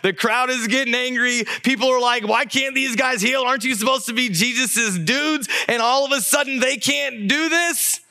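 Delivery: high-pitched, mounting panic; agitated disciples. Retold or imitated. Disciples missing their rabbi as the crowd turns, agitated. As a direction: voice the flustered disciples, pitch climbing. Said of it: "I'm sure these disciples are like".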